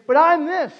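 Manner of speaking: high, loud objection; loud protest. Retold or imitated. Man objecting to the call God put on him, loud protest. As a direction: burst out with an excuse.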